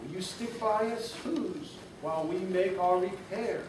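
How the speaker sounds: a hint of a tiny mayor pleading. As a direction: plead from far below. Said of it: "pleaded the voice".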